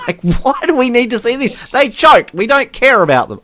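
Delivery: high-pitched laughing exasperation; incredulous rant. Real, not acted. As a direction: protest in disbelief, voice shooting up high. Incredulous rant about the TV coverage.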